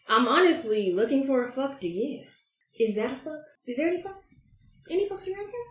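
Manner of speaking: playfully searching, rising high; mock searching. Podcast host to listeners, mock searching high-pitched. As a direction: hunt around for a fuck to give, teasing.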